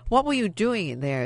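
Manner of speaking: sister's loud accusing demand; too loud. A narrator's reading. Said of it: "her voice too loud".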